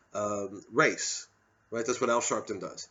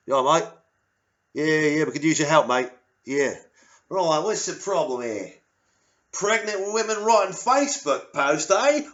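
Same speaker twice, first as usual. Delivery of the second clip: loud, high Australian cop; Australian police accent; 6 semitones higher, 7 dB louder than usual. Retold or imitated. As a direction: swagger in like a tough Aussie officer. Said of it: "bringing Australian police".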